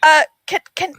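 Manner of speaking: nervous bard begging a chance; timid stammering plea. Acted. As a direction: stutter as you ask permission. Bard timidly pleads with guards.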